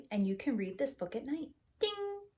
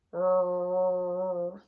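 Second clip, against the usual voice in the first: louder, rumbling mock roar; engine roar; 2 semitones lower, 4 dB louder than usual. Throttles forward on the runway, mock engine roar.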